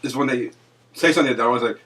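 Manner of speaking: ordinary delivery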